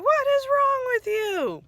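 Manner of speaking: high, incredulous outburst; exasperated. Real, not acted. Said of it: "It's like".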